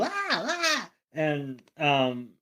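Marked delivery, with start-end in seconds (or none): 0.0-0.9 mock panicked cries. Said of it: "The room goes".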